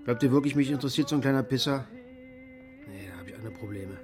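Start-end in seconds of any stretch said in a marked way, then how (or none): 2.9-4.0 whispered, much softer, under the breath; dismissive whisper. Real, not acted. Security man brushing off the boys, dismissive whisper. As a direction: drop it to a tired whisper.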